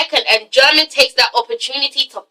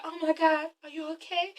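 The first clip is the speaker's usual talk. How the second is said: very soft, higher-pitched fussing; acted concern; 3 semitones higher, 16 dB quieter than usual. Asha at Derek's side after his fall, imitated soft concern. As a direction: hush it right down, imitating tender worry.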